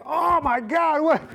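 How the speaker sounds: high, loud, drawn-up cry of alarm; alarmed outcry. Retold or imitated. Owner reacting to his hurt, crying dog, alarmed outcry.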